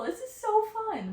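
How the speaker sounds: high, slow delighted squeal; excited. Real, not acted. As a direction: gush with real delight. Excited by the channeled message.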